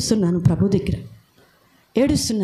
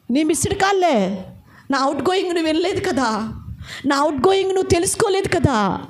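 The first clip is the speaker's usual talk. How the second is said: God pleading with reproach; 7 semitones higher than usual. Acted. God reproaches the distracted believer, pleading and rising. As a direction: voice God knocking and scolding, building.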